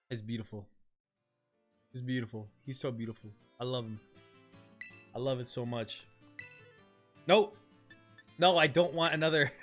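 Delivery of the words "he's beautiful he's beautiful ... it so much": soft, lower adoring murmur; tender. Real, not acted. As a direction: coo over it softly. Adoring new shiny.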